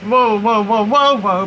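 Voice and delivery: loud, high repeated whoas; startled. Real, not acted. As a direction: exclaim as a big gust hits. Another big gust hits.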